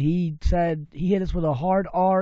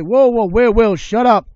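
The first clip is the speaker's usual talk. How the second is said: loud, raised-pitch cut-in; retold urgent shushing; 7 semitones higher, 13 dB louder than usual. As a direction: act out a friend frantically stopping you. Retold friend urgently calming him down.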